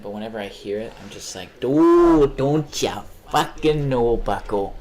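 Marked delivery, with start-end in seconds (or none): 1.6-4.7 loud, high hammed-up Midwest accent. Imitating Midwesterners he hears, loud mock Midwest accent. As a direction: ham up a Midwest dude, slow and loud. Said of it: "the Midwest accent".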